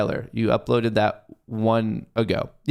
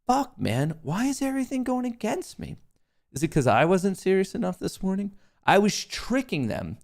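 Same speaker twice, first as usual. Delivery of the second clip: whiny complaint; 8 semitones higher than usual. Real, not acted. Whining at losing trades.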